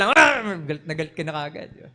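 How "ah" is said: sharp, loud, high irritated yelp; mock angry snap. Snapping at whoever bumped into him, mock angry. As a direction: snap like someone just bumped.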